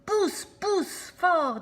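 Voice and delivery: high, loud, slow straining cry. Acted. Sammy strains and cries out while pushing the door. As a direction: strain as if shoving a stuck door. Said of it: "dit Sammy".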